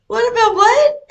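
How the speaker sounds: very high, loud disbelieving question; mock shock, loud and high-pitched; retold incredulous question. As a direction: shoot it back high, totally incredulous. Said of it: "And she goes".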